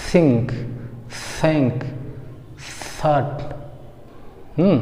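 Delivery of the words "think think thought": tongue between teeth on THINK, THOUGHT, air flowing out; long airy th opening THINK and THOUGHT; native th sound. Shown as a demonstration. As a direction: THINK and THOUGHT with a hissed soft th. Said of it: "क्या बोलेंगे?".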